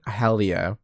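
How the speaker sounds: ordinary talk, nothing marked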